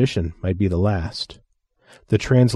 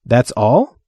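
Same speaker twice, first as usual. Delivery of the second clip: aghast; 3 semitones higher, 4 dB louder than usual. Acted. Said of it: "aghast at what this meant".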